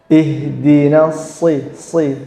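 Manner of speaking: slowed down. Shown as a demonstration. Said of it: "saya perlambat".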